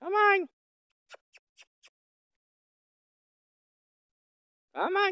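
very high, loud call to the bull. Real, not acted. Rancher calling the bull from afar, high and loud. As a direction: sing it out high to draw him over.

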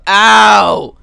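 very loud, high yelp of pain; pained yell. Acted. Player face planting off the bike, pained yell. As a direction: holler in mock agony.

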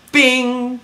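a sharp, high-pitched cartoon bing; ping it out like a bell being struck; a bright little ding as the flower lands; high bing sound effect. Mimics flower hitting the letter.

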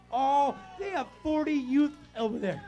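loud, high, driving preacher's cry; full-force preaching. Acted. Pastor preaching hard to the congregation, full force. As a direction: preach it out at the top of the lungs.

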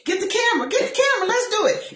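high, loud excited calling to daddy; telling daddy to grab the camera, excited high shout. Retold or imitated. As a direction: yell it out, thrilled at the first steps. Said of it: "I told his daddy".

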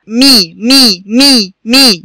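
"مي" repeated as separate, clear "mee" syllables; m with kasra. Shown as a demonstration. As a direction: chant out each "مي" evenly for the class. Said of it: "شدة تحتيها الكسرة".

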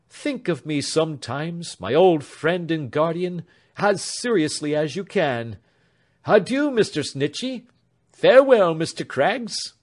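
mean every word of the farewell; lightly voiced young man. A narrator's reading. Alfred to his beloved at parting, earnest.

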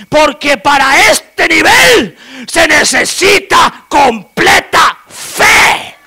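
high, loud, crying shouted sermon; fiery revival preacher; full-force preaching. Real, not acted. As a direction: preach it at the top of your lungs.

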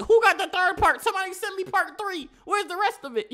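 impatient shout for the rest of the video; mock screaming. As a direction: yell for part three like a frantic fan. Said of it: "start screaming like".

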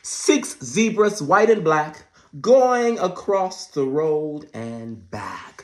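loud, high dramatic read-aloud; excited read-aloud; storybook reading. A narrator's reading. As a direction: announce it like big news to kids. Reading the book.

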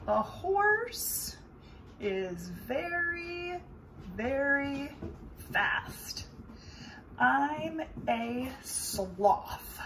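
very slow, stretched-out sloth voice. Acted. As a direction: drag every word like a sleepy sloth. Sloth speaks very slowly.

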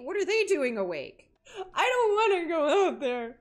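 high, loud, near-crying whine; frightened whine. Real, not acted. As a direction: protest in real fear. Scared of going out in the dark.

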